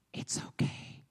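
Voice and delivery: soft, hushed whisper, imitating a kind waiter; angelic whisper. Waiter reassuring customers who won't eat, whisper. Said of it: "he was like an angel".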